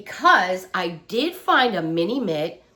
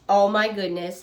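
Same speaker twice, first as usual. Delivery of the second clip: ordinary delivery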